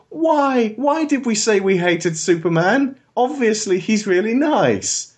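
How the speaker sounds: high, wailing mock lament; mock grieving world. The world regrets hating Superman, high mock lament. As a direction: whine it like the sorry public. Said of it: "the world goes".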